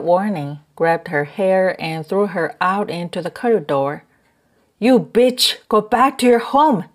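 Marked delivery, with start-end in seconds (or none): 4.8-6.9 higher, louder furious narrated shout; angry yell. A narrator's reading. Husband throwing wife into the corridor, angry yelling. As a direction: yell it to drive her out. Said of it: "he yelled".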